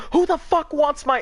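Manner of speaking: high, loud furious shout; angry yell. Retold or imitated. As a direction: storm in yelling. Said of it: "I come storming out".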